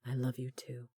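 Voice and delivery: soft whispered reply; tender whisper. A narrator's reading. Corbin whispering his love back to Delaney, tender whisper. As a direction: breathe it back to her.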